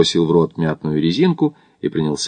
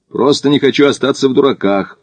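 firm, raised stubborn retort; 6 semitones higher, 3 dB louder than usual. A narrator's reading. Climber refusing to look a fool, stubborn. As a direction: cut the other man off stubbornly. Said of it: "упрямо отрезал Смит".

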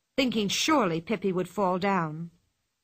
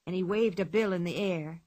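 ordinary delivery, nothing marked

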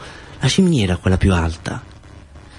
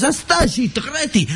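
much higher, slow squeaky jealous jab; jealous Nunzio; slower than usual. Acted. Nunzio jealous of Mustazza at the net, squeaky high whine. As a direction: whine it out of envy. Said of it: "diceva Nunzio, un poco gelosa".

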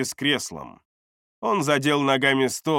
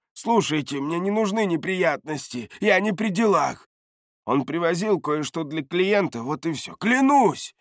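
high-pitched protest rising at the end; agitated pleading; 6 semitones higher than usual. A narrator's reading. Бардак swears he is uninvolved, high agitated pleading. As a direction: plead innocence in a raised pitch. Cornered man pleads innocence.

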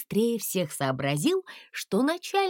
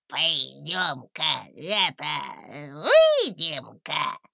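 low, slow cheeky challenge; 5 semitones lower, slower than usual. A narrator's reading. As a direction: call him out cockily. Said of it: "дерзко окликнул он".

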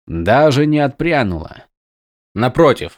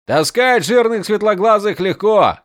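joking soldier; 8 semitones higher than usual. Acted. Soldier joking after rescue.

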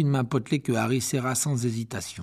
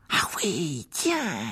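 whispered half-hearted enthusiasm; forced cheer; 5 semitones higher, much slower than usual. A narrator's reading. Ron pretending to be glad of the spiders, whispered. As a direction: fake a little delight. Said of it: "essayant sans succès de se réjouir".

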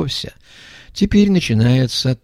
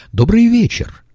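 bright announcer greeting; 13 semitones higher, 4 dB louder than usual. A narrator's reading. Телеведущая здоровается в эфире.

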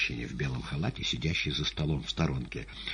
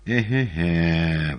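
drawn-out, louder exclamation; exclaiming; 6 dB louder, much slower than usual. A narrator's reading. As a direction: cry it out slowly in dismay. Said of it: "воскликнул Иван".

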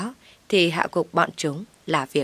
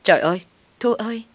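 higher, quick cry of alarm; understated horrified exclamation; mild frightened cry; 5 semitones higher, 3 dB louder, faster than usual. A narrator's reading. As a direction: call out in fright, short of screaming. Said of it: "Tôi sợ hãi hét toáng lên".

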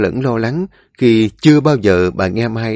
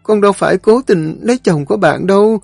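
tearful pleading; 9 semitones higher than usual. A narrator's reading. Mai pleading with her mother through tears, high-pitched. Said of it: "Tuyết Mai bây giờ mới khóc theo rồi nói trong nghẹn ngào".

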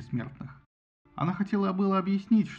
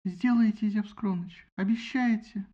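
высокий радостный вопрос; 6 semitones higher, slower than usual. A narrator's reading. Фетида радостно переспрашивает.

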